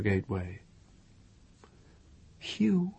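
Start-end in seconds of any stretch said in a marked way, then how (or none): 2.4-2.9 whispering. A narrator's reading. Waking him softly.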